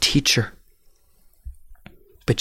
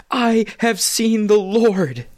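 much higher, louder and slow; joyful announcement; 14 semitones higher, 4 dB louder, slower than usual. Acted. Mary bursting in to tell the disciples, joyful cry. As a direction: cry it out in wonder.

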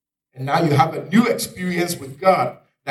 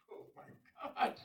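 slow, whispered amazement; hushed, drawn-out gasp of wonder; awed whisper; 17 dB quieter, slower than usual. Acted. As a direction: whisper it in stunned disbelief. Awed reaction to finding water.

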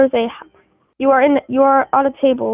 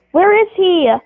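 imitating an impatient date, higher pitch; mock rude demand, voice lifted; rude impatience; 5 semitones higher, slower than usual. Game host acting out a rudely waiting date, impatient. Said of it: "like, rudely waiting".

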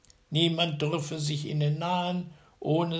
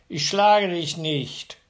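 slow, loud, much higher retort; second giant; 5 semitones higher, 8 dB louder, slower than usual. A narrator's reading. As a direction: wave off the accusation. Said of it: "sagte der andere".

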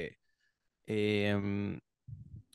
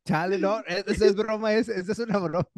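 higher, laughing through the words; 8 semitones higher than usual. Real, not acted. Reassuring his friend it's a joke, laughing through it. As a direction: giggle while reassuring.

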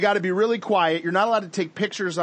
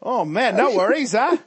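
hammed-up Aussie accent, higher pitched; Australian accent; 6 semitones higher than usual. Shown as a demonstration. Scene: showing off after praise, laughing Australian accent. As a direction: lean into a playful Australian accent. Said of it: "I love that Aussie accent".

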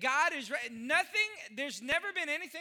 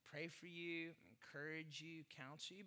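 much softer, lower, tender list; gentle pastor; 9 semitones lower, 15 dB quieter than usual. Real, not acted. Pastor to congregation about his roles, softly. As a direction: say it softly, caringly.

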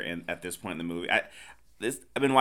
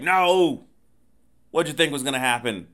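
loud, high "no" then quick rebuke; mock exasperated scolding; 4 semitones higher, 6 dB louder than usual. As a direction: scold them in a hammed-up outburst.

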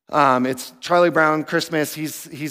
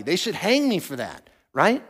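usual speaking voice

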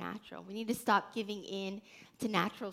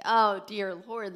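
pleading sigh; 6 dB louder, slower than usual. Retold or imitated. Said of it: "I'm like".

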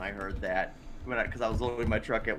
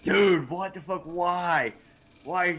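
higher, louder, drawn-out incredulous mock reaction; imitated group disbelief; 6 semitones higher, 4 dB louder, slower than usual. Retelling podcast hosts' shock at the story, mock incredulous. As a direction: stretch it out like appalled friends. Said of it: "everybody's like".

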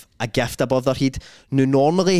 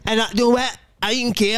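high-pitched daft defiance; comic bam, pitched way up; mock bam; 9 semitones higher than usual. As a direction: put on a high, silly voice. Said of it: "I am a bam".

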